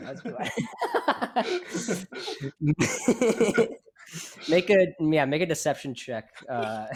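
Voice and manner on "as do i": laughing. Real, not acted. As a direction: let the words dissolve into laughter. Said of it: "repeats while laughing".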